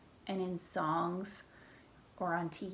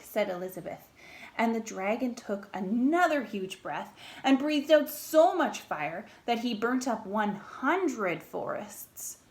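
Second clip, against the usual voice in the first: plain reading voice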